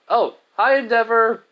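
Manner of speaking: high, loud sing-out hello; bright greeting. Acted. Izuku waves towards Endeavor at the glass, cheerily greeting him.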